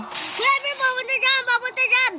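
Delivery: high urgent warning shout; urgent warning call. Real, not acted. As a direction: warn him the bomb is coming. Warning teammate bomb coming. (babalang sigaw)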